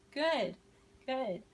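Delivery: normal talking voice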